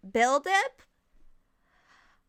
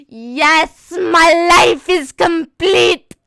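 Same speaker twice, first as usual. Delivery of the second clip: loud, elated declaration, drawn out; fangirl triumph; 4 semitones higher, 7 dB louder, much slower than usual. Retold or imitated. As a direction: proclaim it in hammed-up fangirl ecstasy. Retells her fangirl triumph.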